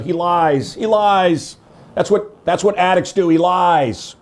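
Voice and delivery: a hint of Tom Arnold's insistence. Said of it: "Tom Arnold said".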